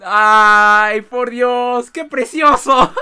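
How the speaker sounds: high, loud, slow laughing gush; overwhelmed by the cute surprise; gushing delight. Real, not acted. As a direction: melt with joy. Moved by the surprise party.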